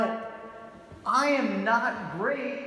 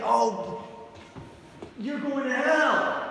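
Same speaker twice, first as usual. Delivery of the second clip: slow, drawn-out "hell" in a hammed-up preacher twang; mock preacher accent; 3 semitones higher, much slower than usual. Mocking preachers who warn passersby, slow twanged "hell". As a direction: warn of doom like a cartoon street preacher. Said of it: "that one has an accent too".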